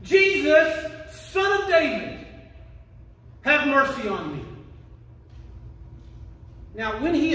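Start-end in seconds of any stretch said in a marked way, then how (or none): 0.0-4.4 loud, high acted shout to Jesus; crying out. Blind beggar shouting to Jesus for mercy, loud crying out. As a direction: call out slowly, pitched high, then let the plea drop. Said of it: "he shouted out".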